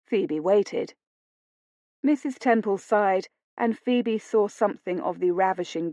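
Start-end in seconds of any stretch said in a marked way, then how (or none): none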